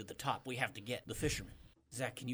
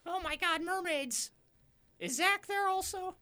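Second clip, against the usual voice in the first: very high squeaky fisherman; 13 semitones higher, 3 dB louder than usual. Acted.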